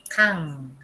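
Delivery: 강 held low on a humming English "ng"; final NG. Shown as a demonstration. Teacher showing the final consonant, held ng. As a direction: let 강 ring on its closing ng. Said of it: "N G en inglés".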